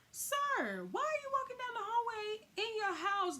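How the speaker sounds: high, scandalized mock scolding; prim, scandalized guest voice; indignant scolding. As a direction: play the shocked houseguest calling him out. Friend scolds naked husband, voiced.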